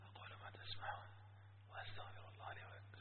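voiceless whisper after losing his voice; breathless whisper, voice completely gone. Retold or imitated. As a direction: push the words out with no voice left. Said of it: "ذهب صوتي تمامًا".

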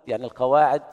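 normal talking voice

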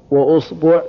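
وأصبع as "wa-usbu'", u after hamza and ba; damma on both. Shown as a demonstration. Teacher listing the damma set, وأصبع as "wa-usbu'". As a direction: say وأصبع with two u vowels, usbu'. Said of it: "ضم الهمزة".

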